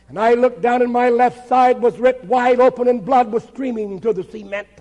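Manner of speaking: ordinary delivery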